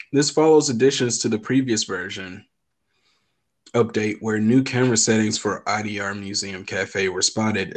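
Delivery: ordinary talk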